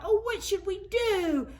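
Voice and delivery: put-on very high piping children's cry; children's voices. A narrator's reading. Narrator voices the worried children, high and piping. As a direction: squeak it out like little kids.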